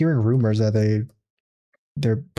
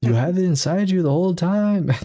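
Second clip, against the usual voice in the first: mock bad dub line; 6 semitones higher than usual. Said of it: "a bad voiceover one".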